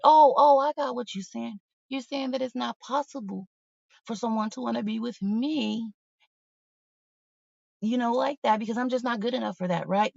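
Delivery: sarcastically quoting her own retort; sarcastic retort. Retold or imitated. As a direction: throw his words back at him, mocking.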